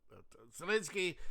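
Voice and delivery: ordinary delivery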